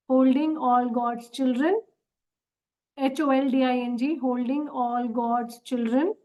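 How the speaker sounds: slowed-down prayer line for writing; slow dictation. Shown as a demonstration. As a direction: say it slowly, word by word, for dictation. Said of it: "मुझे थोड़ा सा slow होकर revise करना पड़ रहा है।". (धीमी गति से लिखवाना)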